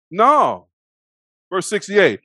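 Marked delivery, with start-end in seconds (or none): 0.1-0.7 loud, higher-pitched denial; mock emphatic refusal; quoted denial. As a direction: deny it out loud, acting the accused. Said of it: "he like".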